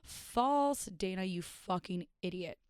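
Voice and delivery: ordinary talk, nothing marked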